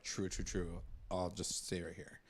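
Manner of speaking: soft, low, meek agreement; meek mumble. Retold or imitated. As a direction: mumble it like he gives up. Voicing meek Dilton character.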